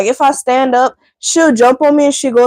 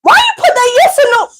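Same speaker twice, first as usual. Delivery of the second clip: dog balking at the rain; voicing the dog; 3 semitones higher than usual. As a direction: speak for the dog, baffled. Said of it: "She looking at that rain like".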